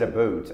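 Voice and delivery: "a boot" for about; Canadian "about". Shown as a demonstration. Actor discussing Michael J. Fox's speech, Canadian "about" as "a boot". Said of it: "I think he had corrected that".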